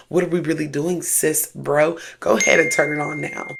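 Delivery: ordinary delivery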